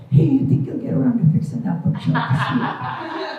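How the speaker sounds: high, chirpy mock nag; breezy nag right after sex; nagging wife. Wife nagging husband about the bookshelf, mock chirpy. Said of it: "turn around and go".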